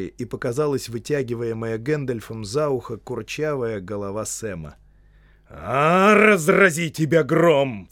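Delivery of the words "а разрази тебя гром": loud, high, drawn-out outburst; bellowed curse from a startled wizard; exclaiming wizard. Acted. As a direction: cry out as a thunderstruck wizard. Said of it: "вскричал Гэндальф".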